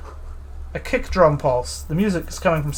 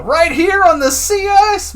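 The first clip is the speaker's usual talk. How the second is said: high, slow, overblown outrage; milk the cop's wounded dignity; squeaky scandalized cop; hammed-up indignation; 4 dB louder, slower than usual.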